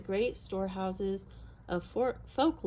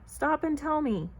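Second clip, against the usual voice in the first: calling out; 6 semitones higher, 3 dB louder than usual. A narrator's reading. Bear calling to rabbits.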